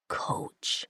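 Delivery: barely voiced, soft name. A narrator's reading. Injured captive to his mate, soft whisper. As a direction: breathe his name gently. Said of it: "he said softly".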